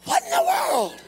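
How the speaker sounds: preacher pressing the church on selling out; preaching at full force. Acted. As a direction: hammer the question at the congregation. Preaching climax.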